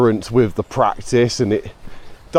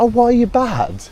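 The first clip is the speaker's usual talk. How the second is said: puzzled foreign learner; 11 semitones higher than usual. Retold or imitated. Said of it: "I was like".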